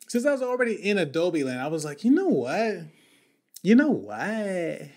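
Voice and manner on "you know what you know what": put-on high, drawn-out Valley girl. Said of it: "sound like a ... Valley girl".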